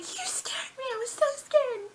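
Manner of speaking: put-on high, breathless scared girl voice. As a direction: squeak it out in relief. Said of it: "and she's like".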